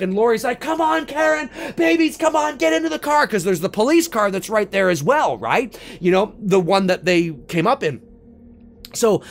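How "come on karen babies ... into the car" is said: high-pitched urgent call; urgent pleading. Acted. Lori herds her family to the police car, urgent. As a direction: hurry them along, voice pitched up.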